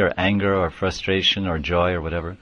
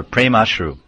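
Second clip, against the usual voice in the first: ordinary talk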